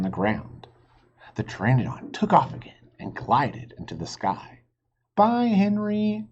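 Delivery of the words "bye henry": high, slow farewell call. Acted. Jack waves off the flying reptile, calling out. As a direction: call goodbye up into the sky. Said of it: "called Jack".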